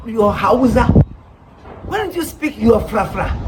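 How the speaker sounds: normal talking voice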